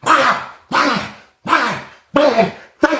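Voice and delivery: high, punctuated impact sounds; punch noises. Retold or imitated. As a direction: imitate blows landing one by one. Imitates punches landing.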